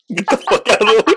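high, loud comic yell. Shown as a demonstration. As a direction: shout the warning. Repeated warning, yelled.

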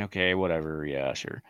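low, quiet imitated brush-off; resigned dismissal. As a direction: give in softly, sinking low as you go. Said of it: "He's probably saying".

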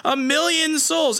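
high, horrified accusation; outraged accusation. Retold or imitated. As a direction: Brood condemns the murderer, voice way up. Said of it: "he's like".

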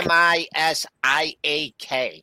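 slow, letter by letter; spelling it out. Real, not acted. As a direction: spell each letter of the name clearly. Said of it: "How do, how do you spell that?".

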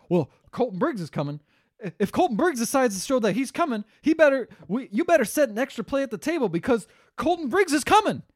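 high-pitched, hammed-up warning; ridiculous movie line. Podcaster imitating an actor's ridiculous line, high and hammed-up. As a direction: play it as an overblown, mock-dramatic announcement. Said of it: "says the most ridiculous line ever".

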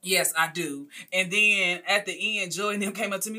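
ordinary talk, nothing marked

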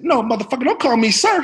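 high, loud mock scolding; Sam Jackson. Star correcting a nervous young actor, exasperated. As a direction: imitate an exasperated star telling someone off. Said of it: "He said".

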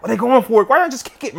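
ordinary delivery

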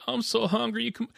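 high-pitched weepy imitation; exaggerated pitiful whine; mock crying. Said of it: "And he's crying".

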